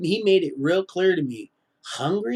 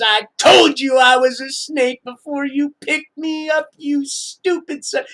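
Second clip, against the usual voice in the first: higher, loud mock yell as the shape-shifter; hammed-up villain reveal, shouted; snake woman snapping; 8 semitones higher, 4 dB louder than usual. The creature sneers at the man who picked her up, in a shout. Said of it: "and she says".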